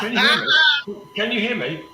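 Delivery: loud, high call down a bad line. Real, not acted. Guest calling out to the hosts over an echoing line, loudly. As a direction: shout to check you can be heard.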